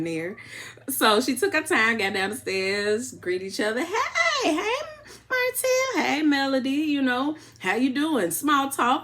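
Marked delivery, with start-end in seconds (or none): 3.8-8.3 high, sing-song Southern country twang greeting; hammed-up Southern country twang, much higher. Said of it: "trying to get my country twang on".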